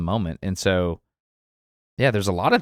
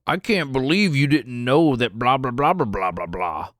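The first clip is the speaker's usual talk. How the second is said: snooty commenter; 4 semitones higher than usual. Retold or imitated. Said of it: "least favorite comments".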